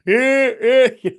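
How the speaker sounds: high, loud imitated laugh; Bartnick's laugh. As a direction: squeal out a mimicked "eh" laugh. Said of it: "Bartnick would do his Bartnick laugh".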